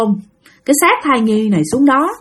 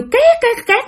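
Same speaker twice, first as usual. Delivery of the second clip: high squeaky "két" noises; imitated teeth grinding; 13 semitones higher, slower than usual. Narrator shows child's teeth grinding, imitated squeak.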